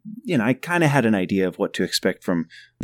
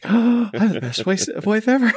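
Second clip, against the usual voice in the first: gushing; 11 semitones higher than usual. Retold or imitated. Overjoyed at wife's reply.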